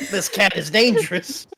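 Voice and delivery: high, loud, excited creature voice with laughter; Beepo. Acted. Note-taker with book in hand declares the cat dangerous, high and excited. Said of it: "ready to take notes".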